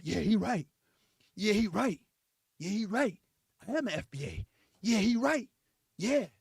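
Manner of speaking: low, dopey repeated assent; mumbled agreement to the stream; agreeing viewer. Acted. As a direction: nod along in a low simple voice. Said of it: "You sitting there".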